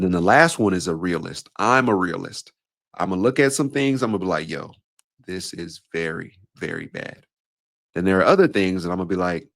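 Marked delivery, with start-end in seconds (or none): 4.5-7.3 slower, hushed and grave; grave verdict. Acted. Realist calling out how bad things are, grave and hushed.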